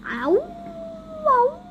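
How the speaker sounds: high, rising cartoon howls; dog howl. Dogman answering what happened, howling.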